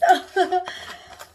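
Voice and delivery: short, loud, high laugh; real laugh. Real, not acted. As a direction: burst into a brief high laugh. Laughs after translating the label.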